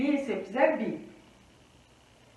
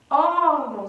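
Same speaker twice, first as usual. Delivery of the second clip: ÓRGÃO with a loud, long ÓR; órgão, strong first syllable falling away; stress on first syllable; 2 semitones higher, 5 dB louder, slower than usual. Shown as a demonstration. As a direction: punch the ÓR of órgão, let GÃO drop. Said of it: "sílaba tônica é o ó".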